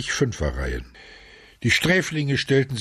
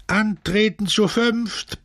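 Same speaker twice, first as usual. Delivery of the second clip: high, drawn-out roar of command; slow, high-pitched bellow; bellowed order; 13 semitones higher, slower than usual. A narrator's reading. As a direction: outdo the last caller, stretching each word. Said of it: "brüllte der Barackenälteste".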